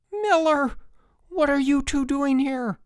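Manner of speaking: high, loud outrage; furious yell. A narrator's reading. Counselor yelling at stowaways in the boat.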